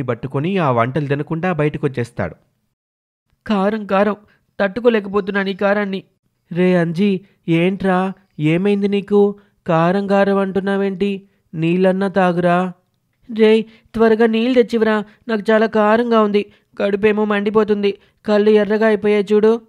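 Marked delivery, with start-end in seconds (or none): 3.5-6.0 lightly acted distressed boy, higher pitch; high, mildly pained character voice. Boy complaining of burning spice, mildly distressed. Burnt by spicy food. 6.5-12.7 put-on higher-pitched voice of a concerned boy; concerned boy friend. Friend questioning a boy in distress, concerned. 13.4-19.6 put-on high, loud, urgent boy voice; distressed, burning boy. Boy begging his friend for water, urgently distressed.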